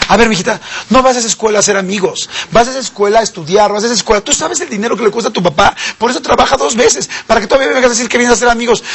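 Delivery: sermón de papá enojado, más agudo; regaña a la hija como papá exasperado; padre regañón. Retold or imitated. (imitando a un papá que regaña)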